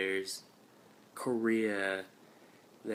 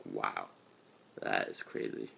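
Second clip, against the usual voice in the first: hushed awed whisper, much lower; whispered amazement; 13 semitones lower, 5 dB quieter than usual. Real, not acted. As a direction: whisper it in disbelief. Reacting to recruiter's travels.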